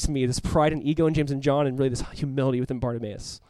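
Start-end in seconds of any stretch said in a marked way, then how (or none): none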